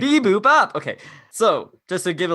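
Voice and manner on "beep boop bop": robot beeps. Pretend. Robot noises.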